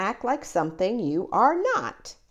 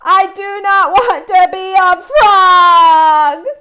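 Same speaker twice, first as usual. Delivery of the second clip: much louder and higher, slow wailing protest; frightened wail; 6 semitones higher, 13 dB louder, slower than usual. Acted. Gerald fears turning into a frog, loud slow frightened wail. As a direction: wail it out in fear.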